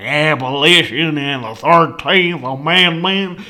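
big words in a slow Southern drawl; Southern accent. Retold or imitated. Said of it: "started talking like a southerner".

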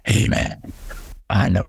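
low, whispered-then-growled demon voice; imitating a stock horror demon, low and menacing; mock movie demon. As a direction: play the cliché exorcism demon, dropped low. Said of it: "the voice of the, of the demon is always like".

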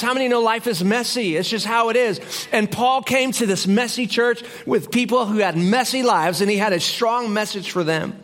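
ordinary delivery

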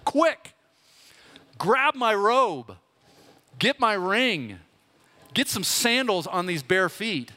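overjoyed father. Retold or imitated. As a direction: burst out with glee, the lost son is home. Dad interrupts, overjoyed at son's return.

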